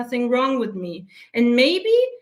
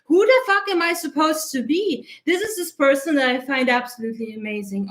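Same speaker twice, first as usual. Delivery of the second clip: ordinary talk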